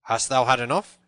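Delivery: loud, grim challenge; grim demand. A narrator's reading. Tanner with staff aloft over Little John, grim. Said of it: "grimly, holding his staff aloft".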